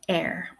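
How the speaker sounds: "air" in an Irish accent with sounded R; Irish pronunciation. Shown as a demonstration. Teacher repeating her Irish version, "air" with R. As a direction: finish "air" on a clear R. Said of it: "Again, I'm sticking in the R".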